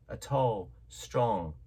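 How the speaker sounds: usual speaking voice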